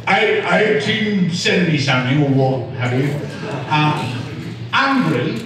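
demonstrated Cockney, lilting upward; playful Cockney patter; Cockney accent. Shown as a demonstration. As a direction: tell the tale as a Cockney storyteller. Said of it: "Can you do a Cockney?".

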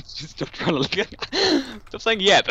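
cracking up mid-sentence, voice jumping high; giggling through the words, barely holding it together; laughing. Real, not acted. Teasing his friend for saying yep, laughing through it.

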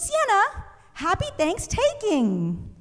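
high, slow chirpy classmate greeting; eager classmate. Retold or imitated. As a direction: sing it out like an eager girl. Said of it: "And she goes".

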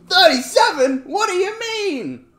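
very loud, high mock shriek; hammed-up outrage, last words drawn out; mock disbelief. Streamer imitating a commenter shocked at thirty-seven, loud mock squeal.

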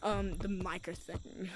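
ordinary talk

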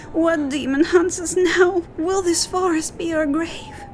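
put-on high, fearful girl voice; a terrified child pleading, pitched high; lost girl afraid of the forest; frightened.

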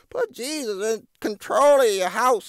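high mocking preachy voice; mocking churchy quote. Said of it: "Talking about".

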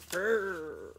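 loud growling roar like a lion. Acted. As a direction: let out a big lion's growl. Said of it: "roared Leo".

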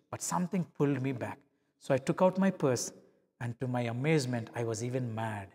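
ordinary talk

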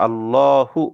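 আল্লাহু with a thick, full-mouthed lam, drawn out; tajweed heavy lam in আল্লাহু. Shown as a demonstration. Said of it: "এভাবে মোটা করে পড়তে হবে".

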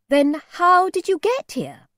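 high, loud, probing question; suspicious questioning. A narrator's reading. Mother catches out the old man, suspicious. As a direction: corner him with the question. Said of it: "My mother was still suspicious".

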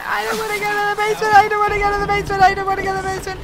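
high, fast frightened protest; fast pleading, voice pitched high; scared whining. Real, not acted. Gamer dragged to the basement, high fast frightened protest.

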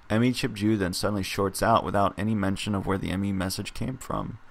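ordinary talk, nothing marked

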